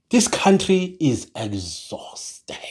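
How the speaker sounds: slow, weary, fading almost to a whisper. Real, not acted. Fed-up vlogger lamenting the state of the country, weary. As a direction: let it trail away, drained.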